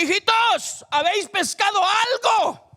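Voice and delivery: put-on high, loud call in a Spain Spanish accent; shouted Bible verse, Spain Spanish accent. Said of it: "Como dice el Reina Valera con el acento español".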